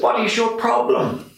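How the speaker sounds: high-pitched, challenging Irish priest; Donal, Irish accent. Retold or imitated. Old parish priest taking his deacon to task in an Irish accent. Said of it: "I'm sorry to do the accent".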